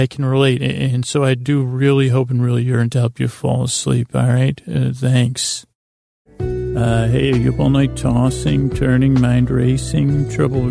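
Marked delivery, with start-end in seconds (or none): none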